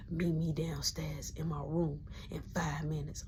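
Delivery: breathy hushing whisper so mama stays asleep; urgent whisper. Retold or imitated. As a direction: shush him in a tight whisper. Whispering at night.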